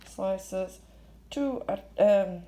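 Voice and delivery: usual speaking voice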